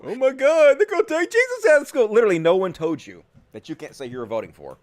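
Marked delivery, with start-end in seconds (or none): 0.0-2.1 high, hammed-up whine; mocking high-pitched alarm; mock panic. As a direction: parody someone scandalized and overwrought. Mocks the clip's complaint.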